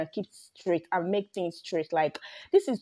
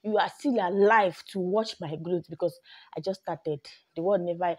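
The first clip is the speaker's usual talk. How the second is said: ordinary talk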